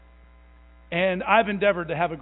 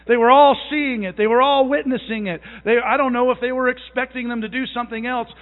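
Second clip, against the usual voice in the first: preaching at full force; 4 semitones higher, 7 dB louder than usual. Acted. Pastor preaching to the church about Paul and Silas, loud and emphatic. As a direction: drive it home to the congregation.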